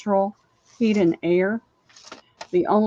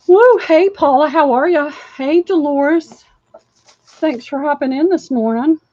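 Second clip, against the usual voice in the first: excited greeting; 7 semitones higher, 8 dB louder than usual. Real, not acted. Excited live-stream greeting to viewers.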